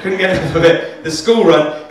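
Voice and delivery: ordinary delivery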